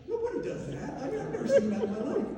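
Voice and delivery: high-pitched, outraged disbelief; incredulous. Retold or imitated. Son reacting to sister eating mom's communion, incredulous.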